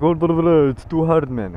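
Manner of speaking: badly faked French accent, babbled then complaining; botched French accent. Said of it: "more of a Lebo rather than a French accent".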